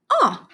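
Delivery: "ah", the short O, pitched high; short O sound. Shown as a demonstration. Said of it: "two sounds that an O has".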